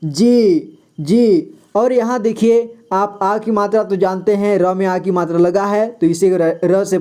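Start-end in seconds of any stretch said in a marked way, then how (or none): 0.0-1.6 जी with a long drawn-out ee; lengthened vowel. Shown as a demonstration. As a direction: stretch each जी a little. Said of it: "जी को थोड़ा लंबा कहेंगे".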